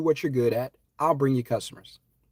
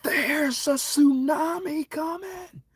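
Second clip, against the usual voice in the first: high, slow, stretched calling shout; screaming a warning; much slower than usual. Retold or imitated. Warning people on the beach of the tsunami, screaming. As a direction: holler down to the beach. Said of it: "I'm screaming".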